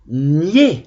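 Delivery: "Niais" said as gné, with a closed é; closed é ending. Shown as a demonstration. Teacher dictating to pupils, "Niais" pronounced with closed é. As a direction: end "Niais" on a tight é, not è. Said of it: "accent aigu pour et".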